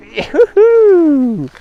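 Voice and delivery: high sing-song whoop of joy; sing song yoo hoo. Real, not acted. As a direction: celebrate the catch, loud and high. First fish landed, celebrating.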